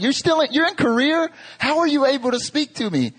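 high, incredulous Southern grandmother imitation; Southern grandma. Grandmother baffled by a call from Korea, imitated high Southern voice. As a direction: question it high in a deep Southern accent. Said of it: "it's deep Southern accent".

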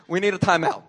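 hammed-up exasperated scolding; mock exasperation. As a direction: play a fed-up boss. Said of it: "Christ doesn't say".

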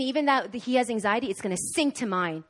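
ordinary delivery

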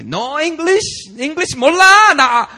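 high, loud shrieking refusal; hammed-up demonic tantrum, piercing; demons screaming. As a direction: screech it like a possessed girl. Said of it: "it's the demons".

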